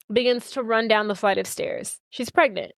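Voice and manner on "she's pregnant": usual speaking voice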